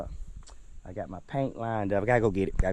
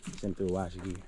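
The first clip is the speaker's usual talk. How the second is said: ordinary talk, nothing marked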